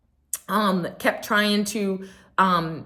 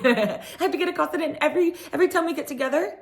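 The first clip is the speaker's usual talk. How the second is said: high, fast parody of someone aggrieved; mock indignant complaint; 10 semitones higher, faster than usual. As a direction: rattle off a hammed-up grievance. Mocking Kyle's indignant complaint.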